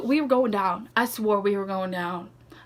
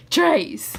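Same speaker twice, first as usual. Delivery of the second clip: louder, higher, laughing out the word; 5 semitones higher, 6 dB louder than usual. Real, not acted.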